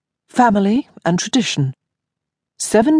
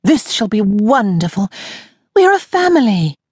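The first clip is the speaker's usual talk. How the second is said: put-on high, eager young lady; cheerful rallying cousin; bright young lady; 5 semitones higher than usual. Honoria's voice, higher.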